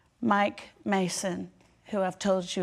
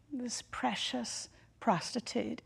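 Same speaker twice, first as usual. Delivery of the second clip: hushed; 4 semitones higher, 5 dB quieter, slower than usual. Real, not acted. Tender aside about Marie.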